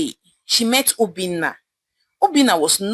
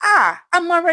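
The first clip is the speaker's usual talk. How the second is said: high, alarmed cry of her name; shocked cry; 8 semitones higher, 3 dB louder than usual. A narrator's reading. Friend recoiling at Amara's engagement news, shocked cry. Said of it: "Chika screamed at her and said".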